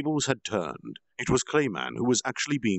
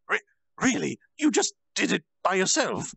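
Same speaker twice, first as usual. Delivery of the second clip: surprised; 11 semitones higher, 5 dB louder than usual. Acted. Speaker surprised at Milim.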